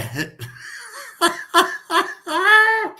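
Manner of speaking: a long, high, delighted laugh; high burst of laughter. Real, not acted. Streamer laughing high while praising a viewer. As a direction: break into a high laugh about a mate. Laughing about the Grim Reaper.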